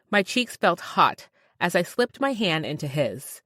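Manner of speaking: ordinary delivery, nothing marked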